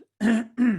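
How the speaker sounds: a rough, loud clear of the throat. Real, not acted. Painter losing his voice, clears his throat mid-demo. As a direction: clear the throat hard, voice going. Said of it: "losing my voice".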